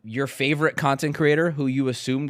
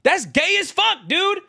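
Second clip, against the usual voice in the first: much higher, louder, slow mocking outcry; exasperated shouting rant; 11 semitones higher, 4 dB louder than usual. Real, not acted. Streamer yelling at Twitter critics to chat, exasperated shouting. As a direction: yell it in disbelief at the critics.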